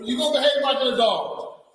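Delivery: high, loud scolding shout on behave; officer shouting at a misbehaving detainee; angry shout. Real, not acted. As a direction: yell it at her like a drill sergeant.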